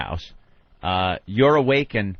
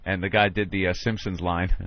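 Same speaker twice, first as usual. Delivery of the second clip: ordinary delivery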